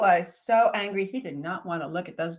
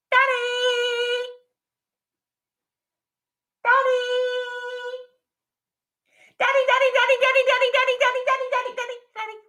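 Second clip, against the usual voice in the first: much higher, loud calling Daddy; yelling Daddy; 8 dB louder, faster than usual. Acted. Baby dragons yelling to wake Daddy, loud high. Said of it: "So they all started yelling".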